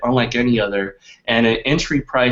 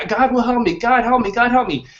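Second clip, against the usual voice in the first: high-pitched, mildly acted calling out; lightly acted high plea; imitated plea for help; 9 semitones higher than usual. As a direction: voice a drowning man's repeated plea. Drowning man praying aloud in story.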